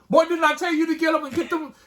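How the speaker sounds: high, loud scolding mother; angry mom. Retold or imitated. Mom yelling at her son to get up, loud scold. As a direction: bark it like a fed-up mom. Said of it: "your mom be mad at you".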